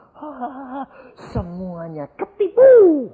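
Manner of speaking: acted gloating laugh, drawn out and rising in volume; big demonic laugh. Preacher acts the demon mocking those it fooled, big laughing voice. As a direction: laugh like a triumphant demon, stretching the words. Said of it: "dia ketawa besar sekali".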